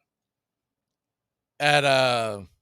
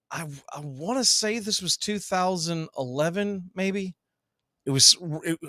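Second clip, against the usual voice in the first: normal talking voice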